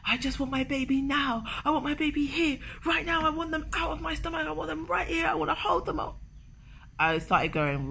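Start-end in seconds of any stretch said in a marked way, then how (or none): 0.1-6.2 put-on high, whiny begging for a baby; mock-tearful plea; tearful pleading. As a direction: plead like a desperate mother-to-be. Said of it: "I started crying and telling Nick".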